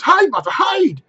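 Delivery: put-on high, loud, slow children's cry; urgent hiding game. Young Cratchits urge Martha to hide, high and loud. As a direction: urge her to hide, playfully.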